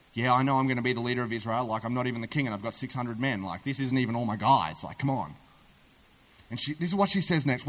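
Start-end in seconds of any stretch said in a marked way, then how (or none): 0.1-5.3 low, fast, impatient grumble; grudging protest. Acted. David brushing off the prophecy reminder, grudging and impatient. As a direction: rattle it off under your voice, pitch dropped.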